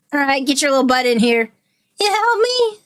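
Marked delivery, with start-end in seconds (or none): 2.0-2.8 high small voice asking for help; frightened kid's plea; pleading child. Acted. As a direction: beg like a little kid.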